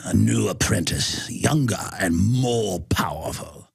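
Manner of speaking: low, sinister imitation of an evil emperor; mock villain voice, low and menacing; Emperor Palpatine. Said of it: "to quote Emperor Palpatine".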